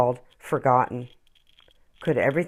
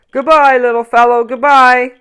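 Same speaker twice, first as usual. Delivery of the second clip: higher, loud goodbye call; tree calling farewell; 8 semitones higher, 12 dB louder than usual. Acted. Tree Brutus calls goodbye to Noel, loud and fond. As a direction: call goodbye across the yard. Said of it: "the tree called to Noel".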